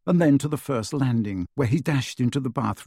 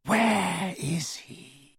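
high, very slow villain voice; cold menace; 7 semitones higher, 4 dB quieter, much slower than usual. A narrator's reading. Dark wizard questioning a terrified woman, high and slow. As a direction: draw it out, high and icy.

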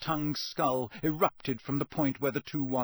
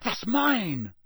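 raised, very slow startled claim; surprised; 11 semitones higher, 4 dB louder, much slower than usual. A narrator's reading. Harry recognizing his wand, surprised. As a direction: blurt it in recognition. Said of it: "he said".

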